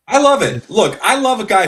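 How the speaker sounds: genuinely excited cheer. Real, not acted. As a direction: blurt it out, thrilled by the clip. Reacting to the emotional interview clip.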